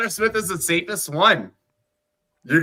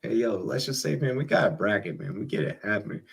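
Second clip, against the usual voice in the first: usual speaking voice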